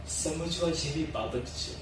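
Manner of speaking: low, soft closing thought; hushed reflection. Acted. Closing a talk on humanity to the nation, hushed reflection.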